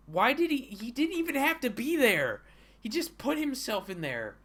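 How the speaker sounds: high-pitched incredulous complaint; exasperated. Real, not acted. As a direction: protest it high, falling away at the end. Exasperated at the author's self-insert.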